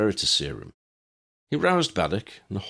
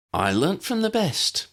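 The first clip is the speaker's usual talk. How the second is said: slow, higher, pleased with herself; bragging; 12 semitones higher than usual. Acted. Ginny showing off to Dennis, slow proud brag. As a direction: savor the boast, draw it out higher. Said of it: "she bragged".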